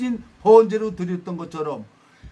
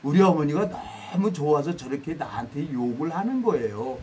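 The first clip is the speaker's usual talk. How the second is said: lower, softer voice for a retold confiding; lightly voiced young woman; 6 semitones lower, 5 dB quieter than usual. Daughter explains her mother's scolding to the team leader, lightly voiced and hushed. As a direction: lightly imitate a young woman, dropping pitch and volume. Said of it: "전라도 처녀가 경상도 총각 반장한테 가가지고".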